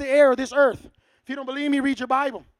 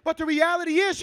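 normal talking voice

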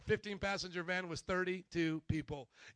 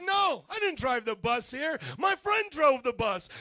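louder, higher, pushed lie; loud nervous lie; 5 semitones higher, 10 dB louder than usual. Retold or imitated. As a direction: blurt the lie out loudly. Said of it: "And I said".

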